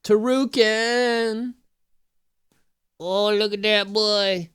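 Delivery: slow, drawn-out adoring coo; tender pet-owner coo; cooing to the cat. Real, not acted. Cooing over her cat.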